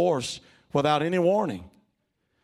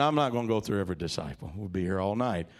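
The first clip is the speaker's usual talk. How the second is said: normal talking voice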